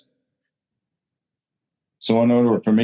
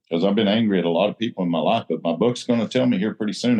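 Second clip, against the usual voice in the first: ordinary talk, nothing marked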